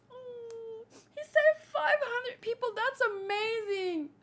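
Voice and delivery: high, teary voice breaking through the line; mock crying. Streamer voicing a moved character, teary and high. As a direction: choke up as you read it.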